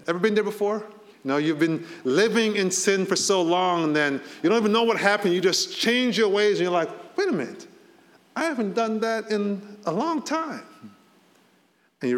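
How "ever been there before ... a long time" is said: animated preaching. Real, not acted. Preacher rousing the congregation about leaving sin behind, excited. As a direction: pull the room in with rising energy. Lively sermon build, raised voice.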